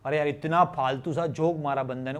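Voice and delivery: normal talking voice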